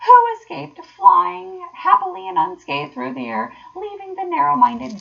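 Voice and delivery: high, loud triumphant boasting; gleeful, crowing self-praise; ecstatic self-admiration. A narrator's reading. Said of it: "he cried in ecstasies of self-admiration".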